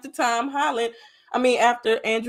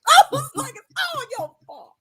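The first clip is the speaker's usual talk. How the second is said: crying blame; 5 dB louder than usual. Retold or imitated. Fan blaming May for the death, crying. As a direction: sob the blame at her.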